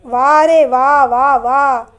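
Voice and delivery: slow, drawn-out sarcastic wa re wa; hammed-up hurt pride; mock offended. A narrator's reading. Younger brother feigning offence at being left out, sarcastic.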